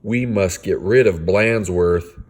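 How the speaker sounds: usual speaking voice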